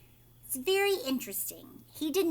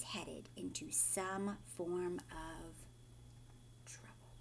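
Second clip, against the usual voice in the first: ominous hush; 4 semitones lower, 12 dB quieter, slower than usual. Acted. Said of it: "suspense ending".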